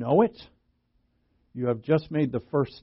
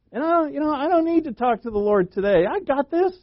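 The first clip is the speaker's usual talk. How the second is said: high-pitched, mock self-assured brush-off; breezy overconfidence; 11 semitones higher than usual. Believer shrugging off talking to the Lord, mock overconfident. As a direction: play someone cockily sure they need no help.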